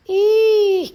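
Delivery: sudden high loud "ih"; a loud high shriek of surprise; high squeal. Real, not acted.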